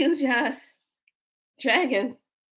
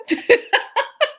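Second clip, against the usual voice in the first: squealing burst of laughter; shrieking laugh at her own story; high laugh; 7 dB louder than usual. Real, not acted.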